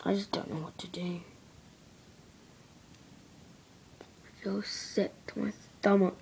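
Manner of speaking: unvoiced whisper, sick and worried. Real, not acted. Alone to the camera, a real worried whisper. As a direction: breathe the words out with no voice.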